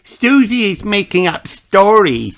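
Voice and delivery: put-on high tattling child voice; small girl tattling to her mother; telling on her. A narrator's reading. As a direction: tell on your friend to mummy. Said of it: "cried Peppa".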